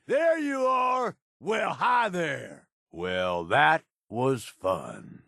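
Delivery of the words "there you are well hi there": slow taunting call. Acted. Spotting enemy, taunting.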